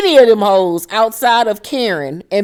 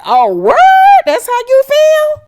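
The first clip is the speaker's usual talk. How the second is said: mock disbelief; 6 dB louder than usual. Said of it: "everybody looking like".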